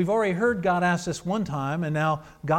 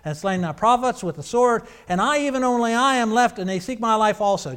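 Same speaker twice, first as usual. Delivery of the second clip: scripture reading; 3 semitones higher, 7 dB louder than usual. Acted. Reads Elijah's complaint aloud.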